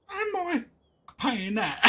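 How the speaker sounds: high, loud disbelief; outraged that they'd even ask; incredulous. Real, not acted. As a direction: am I really paying that. Outraged at the price.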